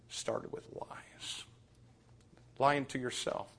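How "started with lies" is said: voiceless, very slow whisper; grave whispered warning; hushed. Acted. Solemn punchline.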